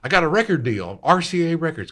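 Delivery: put-on high, loud proud boy. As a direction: boast about the band. Young [name] voiced.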